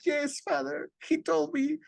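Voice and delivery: high, soft penitent woman's voice; imitated pious woman, small and high; Chabelita. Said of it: "she would be like".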